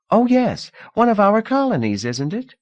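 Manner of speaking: put-on breezy rich girl offering comfort; Lida, young hostess.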